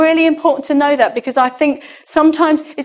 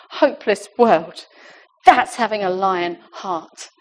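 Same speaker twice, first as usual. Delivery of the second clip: ordinary talk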